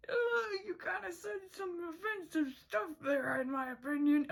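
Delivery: hurt little sister protesting; Polly voice. Retold or imitated.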